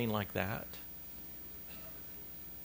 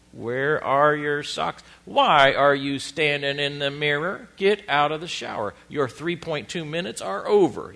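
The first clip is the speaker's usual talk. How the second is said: drill sergeant; 6 dB louder than usual. Retold or imitated. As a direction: drill sergeant hammering out each order. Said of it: "a drill sergeant".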